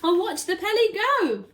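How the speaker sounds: loud, gleeful, teasing monkey; cheeky monkey shout. Acted. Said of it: "shouted the monkey".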